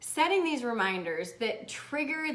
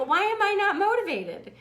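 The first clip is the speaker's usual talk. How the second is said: higher-pitched playful complaint; mock exasperation; 8 semitones higher, 3 dB louder than usual. Host repeats the struggler's question, mock exasperated. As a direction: plead it in comic frustration.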